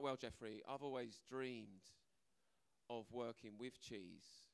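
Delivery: slow, low, dreamy confession; dreamy mock sincerity. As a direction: savour every word like a lifelong dream. Mock earnest interview answer.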